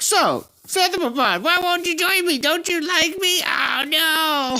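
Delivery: mock whiny D. Mocking D's whine as Seth joins the stream. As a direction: beg in a high teasing whine. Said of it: "D's gonna be all like".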